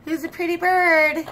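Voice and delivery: high sing-song phrase; teaching a parrot. Real, not acted. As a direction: say it up high for the bird to copy.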